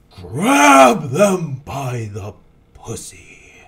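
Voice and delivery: ordinary talk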